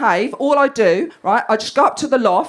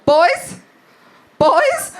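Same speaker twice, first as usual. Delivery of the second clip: put-on high, rising call out to the boys; mum telling off; 6 semitones higher, 3 dB louder than usual. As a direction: imitate a mum's carrying call, pitched up. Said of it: "my voice is just travelling across the campus".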